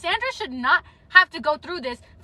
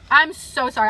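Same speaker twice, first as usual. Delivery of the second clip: ordinary talk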